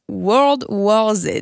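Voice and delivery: careful slow English title in a French accent; English accent attempt. Real, not acted. Student introducing her film, trying an English accent. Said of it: "excusez mon accent, euh, English".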